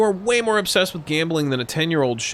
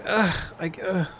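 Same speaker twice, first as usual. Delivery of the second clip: reluctant giving in; 8 semitones higher than usual. Retold or imitated. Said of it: "I'm just like".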